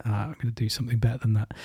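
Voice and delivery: quiet, fast aside; quick soft mutter. Acted. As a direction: toss it off under your breath. Second pig boasts quietly.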